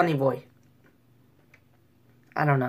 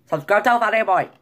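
loud, higher imitation of Japanese accent; forceful put-on Japanese accent; mock Japanese accent; 5 semitones higher, 6 dB louder than usual. Shown as a demonstration. Vlogger doing his best for his plug, mock Japanese.

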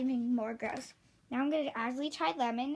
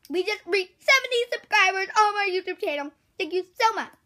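excited; 4 semitones higher, 9 dB louder than usual. Real, not acted. Thanking subscribers excitedly.

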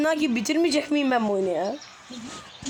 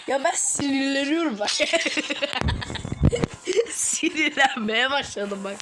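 laughing from frustration. Real, not acted. Gamer losing patience with the game, laughing through it. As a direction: laugh as the annoyance boils over. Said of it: "Sinirden gülmeye başladım".

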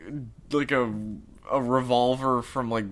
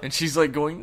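ordinary talk

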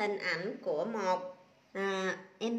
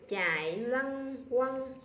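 slow reading. Shown as a demonstration. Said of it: "Cô đọc lại".

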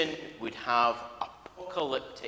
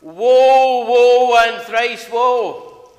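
high, loud, drawn-out wail of doom; Senna the soothsayer; 11 semitones higher, 16 dB louder, much slower than usual. Acted. Preacher opening his sermon, wailing as the soothsayer of doom. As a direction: wail it like a doom-laden TV prophetess. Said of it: "said Senna the soothsayer".

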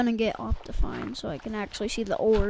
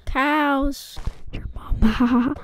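taunting whisper; 4 semitones higher, 3 dB louder, much slower than usual. Acted. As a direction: whisper a joke insult.

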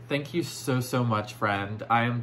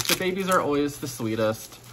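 ordinary talk

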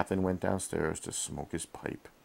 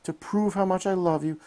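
much higher and louder promise; higher earnest plea; 12 semitones higher, 4 dB louder than usual. A narrator's reading. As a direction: prove your love. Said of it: "she cried".

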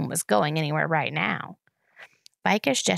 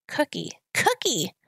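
put-on slow, higher, sputtering little kid; sputtering toddler; 7 semitones higher than usual. A narrator's reading. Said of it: "sputtered Boots".

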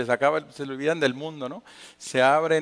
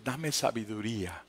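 much softer, lower murmured plea; quiet prayer; 6 semitones lower, 9 dB quieter than usual. Acted. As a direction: pray it under your breath. (oración en voz baja: dame sabiduría)

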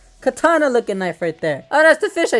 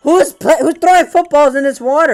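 raised, louder mock indignation; mock outrage; 5 semitones higher, 5 dB louder than usual. Player demands who pollutes his waters, mock outrage.

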